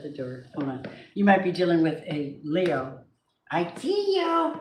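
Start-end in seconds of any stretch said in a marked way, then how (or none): 3.5-4.5 very high, slow pet talk. Real, not acted. Owner teasing her hiding cat, very high and slow.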